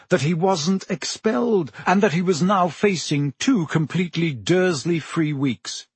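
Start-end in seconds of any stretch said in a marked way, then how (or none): none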